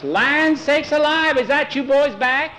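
high, drawn-out surprised holler; woman calling out. A narrator's reading. Mother hails the returning boys, high surprised call.

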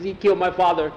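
acted grievance, pitch held up; aggrieved accusation. A narrator's reading. Boy telling Jackie about Jose, aggrieved accusation.

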